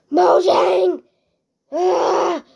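loud, high exasperated yell; frustrated shout. Real, not acted. Player calling out Mojang over creepers, frustrated shout. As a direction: holler in frustration. Yelling at the game's makers.

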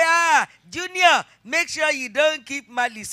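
loud, high mother's warning to Junior; mock parent scolding. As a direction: call Junior sharply, like a parent. Parent voiced scolding child.

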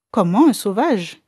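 exclamation surprise, plus forte; s'écrier, stupéfait; s'écrie. Acted. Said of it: "s'écria l'autre". (l'interne s'écrie, surpris)

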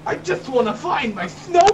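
very high, drawn-out wail; distraught yelling. Acted. Cat owner pleading with police, high distraught yell. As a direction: cry out desperately for a lost pet. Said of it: "you can't yell at us".

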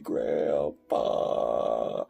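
very slow, stretched-out plea; pleading puppet boy. Acted. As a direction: drag out every word to Grandpa. Acts puppet pleading to Grandpa.

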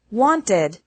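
"wanted" in two syllables, WANT-id; "wanted" with a clear "tid" ending; ED as extra syllable. Shown as a demonstration. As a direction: sound the ED of "wanted" as its own syllable. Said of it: "E D only has an extra syllable".

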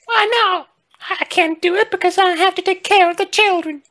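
very high, sing it out in character; high-voiced game character. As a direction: put on a squeaky-high character voice.

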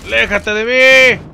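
panicked scream. Real, not acted. Gamer screaming at the enemy chasing him.

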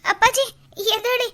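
high, loud tearful cries; sobbing alarm over father; crying in panic. Acted. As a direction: daughter crying out for her collapsed father. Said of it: "ಯಾಕೆ ಪುಟ್ಟಿ ಅಳ್ತೀಯ?".

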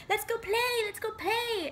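put-on high, eager small child pleading; little kid voice. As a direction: beg like a five-year-old tugging your sleeve. Young child begging to play.

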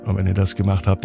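ordinary delivery

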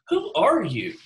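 pointed, authoritative question; demanding. Retold or imitated. Priests confronting John, demanding who he is. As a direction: press him like an official. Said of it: "That's the tone we kinda need".

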